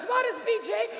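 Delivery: high-pitched questioning mom voice; imitating puzzled mom. Mother asks son what it means, puzzled mom impression. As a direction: ask as mom, high and innocent. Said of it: "And she goes".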